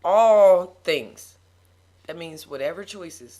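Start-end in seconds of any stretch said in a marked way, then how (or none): 0.0-1.4 loud, drawn-out ALL THINGS; slow. Shown as a demonstration. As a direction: say ALL THINGS slowly and weightily. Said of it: "I'm gonna say it slow".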